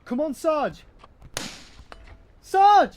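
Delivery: desperate scream. Acted. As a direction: scream his name in terror. Sergeant hit, soldier screams.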